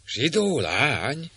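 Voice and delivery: the disguised priest caught off guard; startled exclamation. Acted. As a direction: catch on the word in surprise. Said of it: "kiáltott fel Cedric".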